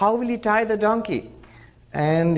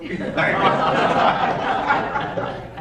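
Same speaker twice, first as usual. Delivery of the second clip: a quick breathy burst of laughter; short laugh; 8 semitones higher than usual. Real, not acted. Teacher amused by the donkey that won't move, laughing.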